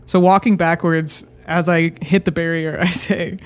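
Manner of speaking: usual speaking voice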